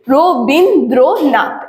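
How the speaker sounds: রো বিন দ্রো নাথ as four separate beats; রো বিন দ্রো নাথ split apart for a class; syllable by syllable. Shown as a demonstration. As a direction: give each of রো, বিন, দ্রো, নাথ its own stress. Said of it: "আগে রো তারপরে বিন বলছি".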